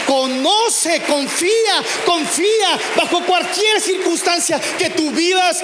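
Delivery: rally the congregation to trust, full force; fiery preaching. Acted.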